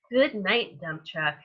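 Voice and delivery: usual speaking voice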